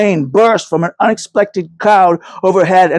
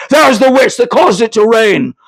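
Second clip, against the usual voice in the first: accusing horseman; 6 semitones higher, 3 dB louder than usual. A narrator's reading. Armed horseman accuses the witch.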